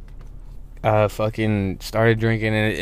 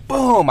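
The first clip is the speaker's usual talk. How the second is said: mock boom; 12 semitones higher than usual. Retold crash while falling.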